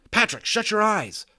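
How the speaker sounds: high, loud warning shout; urgent shout. Acted. Omri warns Patrick before the shot, urgent shout. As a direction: yell the warning fast.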